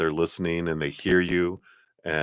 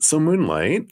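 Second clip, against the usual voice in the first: usual speaking voice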